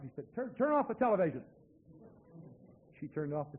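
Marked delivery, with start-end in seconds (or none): 0.3-1.4 loud, higher holler across the house. Retold or imitated. Man hollering to his wife to turn the TV off. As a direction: call out sharply to the wife. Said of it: "He hollered to his wife".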